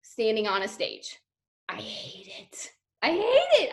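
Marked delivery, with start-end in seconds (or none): 1.7-2.7 whispered. Acted. Stage dread, whispered then cried out. 3.0-3.7 pitched up, exclaimed; high exclamation. Acted. Stage dread, whispered then cried out.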